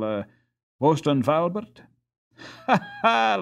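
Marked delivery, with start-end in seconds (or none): 2.4-3.4 a high, read-out ha ha; spoken laugh. A narrator's reading. Jacob mocking the little fellow, a high laugh. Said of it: "laughed Jacob".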